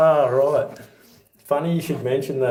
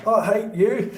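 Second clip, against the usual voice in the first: sulky retort; 9 semitones higher, slower than usual. Retold or imitated. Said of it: "So he goes".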